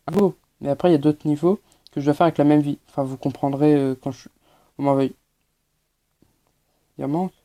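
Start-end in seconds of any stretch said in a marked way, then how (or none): none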